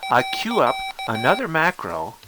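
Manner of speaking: usual speaking voice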